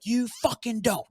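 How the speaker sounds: very high mock yell; high-pitched retold outburst. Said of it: "because he's just yelling".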